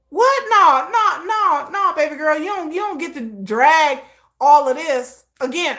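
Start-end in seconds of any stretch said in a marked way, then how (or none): 0.1-0.4 high, sharp disbelief, pitched far up; incredulous what. Real, not acted. Reacting to settlement offer. 0.5-4.7 sassy refusal. Real, not acted. Rejecting her offer, sassy.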